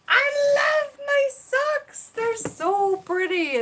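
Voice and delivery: very high, loud, drawn-out squeal of delight; gushing excitement. Real, not acted. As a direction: gush at full volume, stretching the words slowly. Gushes over her magic socks.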